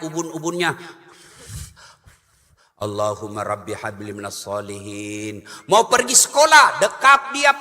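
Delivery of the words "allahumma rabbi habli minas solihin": soft murmured prayer. Acted. Mother prays over her child while stroking his head, softly. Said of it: "usap-usap kepalanya".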